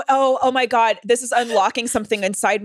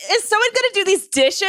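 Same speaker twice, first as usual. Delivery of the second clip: mock roommate; 11 semitones higher, 4 dB louder than usual. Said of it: "And she's like".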